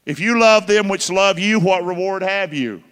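scripture recitation. Acted. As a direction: proclaim the verse. Quoting scripture.

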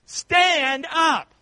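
high, loud preached shout; rally them to their feet; shouted call. Real, not acted. Preacher calling the church to stand, shouted.

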